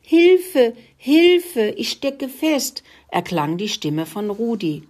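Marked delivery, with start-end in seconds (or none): 0.0-2.8 high, loud cry for help, stretched at the end; stuck, calling for help. A narrator's reading. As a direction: call out in alarm, wedged tight. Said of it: "ein fürchterliches Stöhnen und Ächzen". (hoher, lauter Hilferuf)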